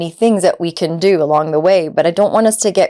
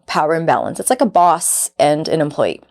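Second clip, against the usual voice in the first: ordinary talk, nothing marked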